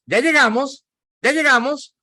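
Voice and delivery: high-pitched imitation of pestering kids; impatient child. Kids in the car on vacation, imitated high impatient voice. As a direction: pipe up like a child nagging from the back. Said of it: "van los niños".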